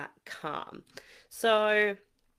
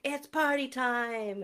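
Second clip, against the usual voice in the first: higher, slow, gleeful announcement; party time; 6 semitones higher than usual. Shown as a demonstration. Top of the energy line for the kids, gleeful. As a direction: throw your arms up for the party. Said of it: "at the end, we're like".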